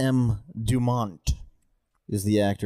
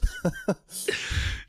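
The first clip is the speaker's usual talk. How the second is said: high laugh; 5 dB quieter than usual. Real, not acted. Laughs at own bad luck.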